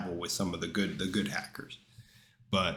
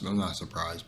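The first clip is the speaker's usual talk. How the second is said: ordinary delivery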